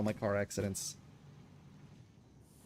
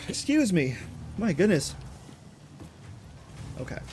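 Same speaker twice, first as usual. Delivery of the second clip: ordinary talk, nothing marked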